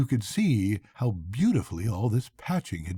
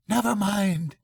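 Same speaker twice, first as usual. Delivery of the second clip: much higher, cheering him up; encouraging cry; 9 semitones higher than usual. A narrator's reading. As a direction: lift his spirits with a bright call. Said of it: "he cried encouragingly".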